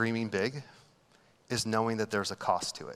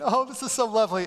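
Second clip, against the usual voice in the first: mock weepy wife; 8 semitones higher, faster than usual. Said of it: "my wife is, like, crying".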